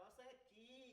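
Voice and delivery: soft mimicking squeak on "കീ"; mock the chicken call back quietly; Jose answering the servant, soft mocking echo; mocking reply. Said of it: "very mocking manner".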